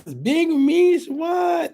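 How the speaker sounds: high, drawn-out squeal; gleeful cheer. Real, not acted. Host cheering her friend's win, gleeful squeal. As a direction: stretch it out in a celebrating squeal.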